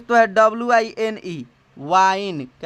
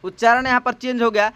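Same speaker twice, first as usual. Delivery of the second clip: usual speaking voice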